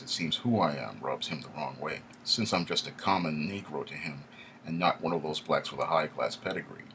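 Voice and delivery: usual speaking voice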